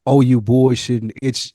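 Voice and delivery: ordinary talk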